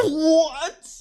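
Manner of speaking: high, incredulous "what" through laughter; laughing disbelief. Real, not acted. Streamer baffled by a puncher who apologizes, laughing disbelief.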